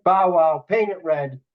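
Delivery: diner waitress lingo. Retold or imitated. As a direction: bark the order back like a diner waitress. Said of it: "she'll be like".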